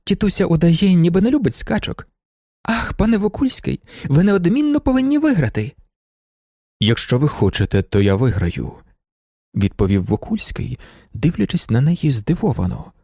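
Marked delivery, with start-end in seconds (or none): 2.6-5.8 higher-pitched, eager plea; eager urging. A narrator's reading. Lady urging Wokulski to win the race, eager. 6.8-8.7 lower, understated reply; lightly voiced suitor. A narrator's reading. Wokulski answering the lady he admires, low and understated.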